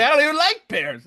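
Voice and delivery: high, laughing burst of a punchline; laughing outburst. Real, not acted.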